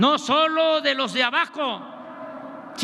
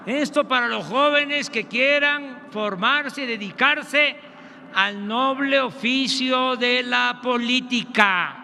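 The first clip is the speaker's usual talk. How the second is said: ordinary delivery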